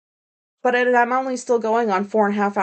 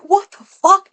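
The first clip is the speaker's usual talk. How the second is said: shocked; 9 semitones higher, 7 dB louder, slower than usual. Retold or imitated. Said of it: "I was like".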